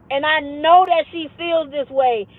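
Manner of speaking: normal talking voice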